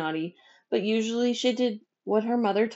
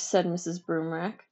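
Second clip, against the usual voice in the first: ordinary delivery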